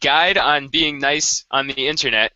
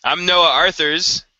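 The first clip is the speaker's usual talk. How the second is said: host intro; 4 semitones higher, slower than usual. Acted. Co-host introducing himself on camera, slow and bright. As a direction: announce your name to the viewers.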